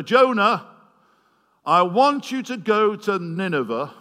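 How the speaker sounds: loud, high, commanding call; booming voice of God. Acted. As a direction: proclaim it like God summoning his prophet. God calling Jonah to mission.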